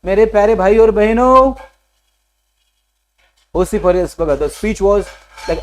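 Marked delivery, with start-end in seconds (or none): 0.0-1.5 loud, raised-pitch rally address; imitated orator's shout; shouting into a mic. Shown as a demonstration. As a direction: call out to the whole crowd like a speaker at a microphone. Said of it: "mike मध्ये कोणतरी ओरडतं".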